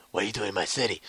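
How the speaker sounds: low menacing caped vigilante; lightly voiced Batman. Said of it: "Batman's like".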